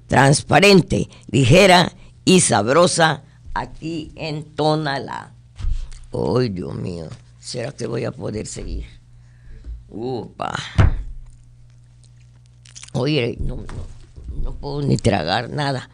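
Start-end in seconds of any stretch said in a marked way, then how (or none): 6.1-15.9 feeble faked weakness. Host acts weak and sick.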